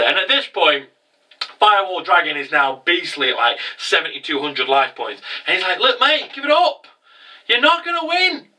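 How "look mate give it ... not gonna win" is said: higher-pitched cocky taunt. Retold or imitated. Duelist telling his opponent to give it up, taunting. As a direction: tell them it's hopeless, voice lifted.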